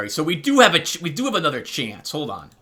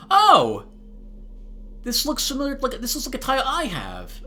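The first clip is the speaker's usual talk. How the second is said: high, loud "oh", then high-pitched recognition; excited squeal of recognition easing in volume; real surprise; 10 semitones higher, 3 dB louder than usual. Real, not acted. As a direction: burst out high as you spot something familiar. Delighted surprise at found item.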